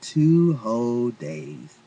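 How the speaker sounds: slow and proud. Acted. Husband answers, smiling.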